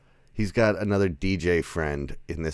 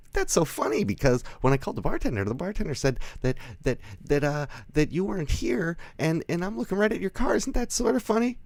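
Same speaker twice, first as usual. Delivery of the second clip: very high, put-on falsetto chatter; mock sweet crazy woman; 13 semitones higher than usual. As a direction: imitate an unhinged woman in a piping high voice. Voicing unhinged woman confronting Clint.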